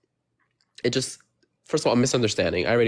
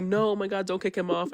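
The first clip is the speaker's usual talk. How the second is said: higher-pitched imitation of pleading fans; mock pleading; 7 semitones higher, 4 dB quieter than usual. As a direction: lightly mimic viewers begging. Imitates viewers pleading for him.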